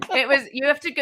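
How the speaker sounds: ordinary talk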